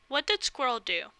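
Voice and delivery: plain reading voice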